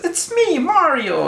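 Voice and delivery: very high, bouncy cartoon plumber; Mario voice. Vlogger mimicking Mario in a Japanese ad, very high cartoon voice. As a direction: squeak it out like a video game mascot. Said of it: "it says".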